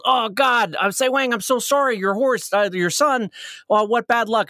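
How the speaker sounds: high-pitched acted commiseration; dismayed neighbor. Neighbor to father whose son is hurt, dismayed. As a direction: fuss over a friend's misfortune. Neighbor consoles after son's accident.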